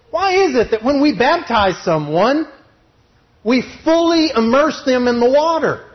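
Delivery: loud, high, driving sermon voice; fiery preaching. Acted. Preacher drives his point home to the church, fired up. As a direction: preach it hard to the congregation.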